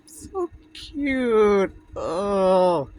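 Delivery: real sobbing; crying. Real, not acted. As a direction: cry out how cute it is. Reader overwhelmed by touching ending.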